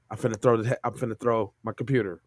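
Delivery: ordinary delivery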